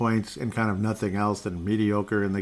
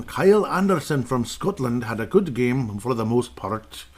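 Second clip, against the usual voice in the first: slow, lightly put-on Scottish accent; 2 semitones higher than usual. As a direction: read the stats in a Scottish brogue. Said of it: "that's another accent".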